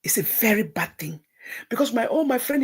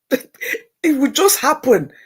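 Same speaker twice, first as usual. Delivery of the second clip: laughing; 5 semitones higher, 9 dB louder, much slower than usual. Real, not acted. Laughing about answered faith.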